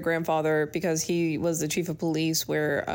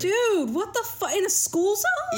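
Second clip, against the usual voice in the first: shocked disbelief; 13 semitones higher than usual. Real, not acted. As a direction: react in total disbelief to the confession. Friend shocked by confession.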